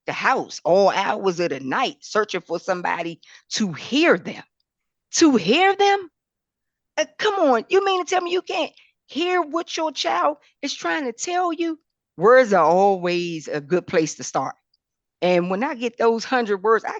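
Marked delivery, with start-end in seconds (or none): 5.1-11.8 mock astonishment pitched high; incredulous. Real, not acted. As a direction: come on, you can't hear them?. Incredulous challenge to parents.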